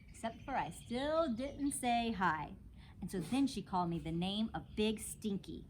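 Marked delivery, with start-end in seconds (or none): none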